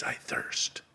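breathy, hushed whisper; a dry, faint whisper of thirst. Acted. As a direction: whisper it, parched and spent. The same words repeated in a whisper.

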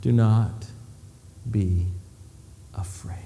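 soft, slow, tender; gentle reassurance. Acted. Jesus reassures the kneeling Peter, soft and tender. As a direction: comfort him quietly.

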